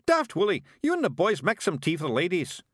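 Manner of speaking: high, loud shouted command; loud high pixie call; shouted order. A narrator's reading. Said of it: "shouted Rob Anybody".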